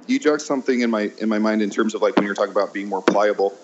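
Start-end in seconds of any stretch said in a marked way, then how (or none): none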